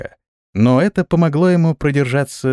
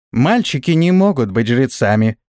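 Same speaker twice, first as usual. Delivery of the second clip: plain reading voice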